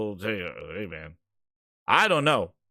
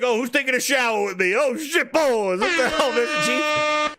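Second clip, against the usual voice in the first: mock locker room guy; 6 semitones higher than usual. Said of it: "He's like".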